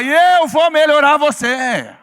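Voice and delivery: loud, high, slow drawn-out yell; rallying shout. Shown as a demonstration. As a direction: stretch every word into a big shout. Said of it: "dá um grito aí e fala bem alto".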